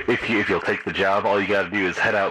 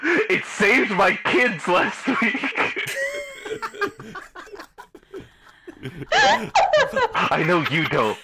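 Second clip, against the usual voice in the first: laughing; much slower than usual. Real, not acted. GM laughing through own joke.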